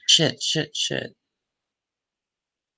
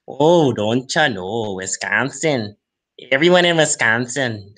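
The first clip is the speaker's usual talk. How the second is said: hammed-up Wisconsin accent with long vowels. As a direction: exaggerate a folksy Wisconsin accent. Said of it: "I know they don't talk like this".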